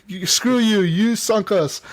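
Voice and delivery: high-pitched mock outrage; retold accusation. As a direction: fire back, playfully indignant.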